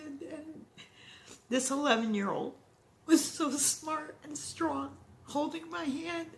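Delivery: crying as she remembers her son; real crying, voice breaking. Real, not acted. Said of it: "this makes me sad".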